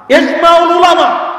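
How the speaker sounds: shouted high and loud; slow, ringing shout of consensus; preacher's shout. Real, not acted. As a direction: proclaim it at full voice. Preacher declaring scholarly consensus.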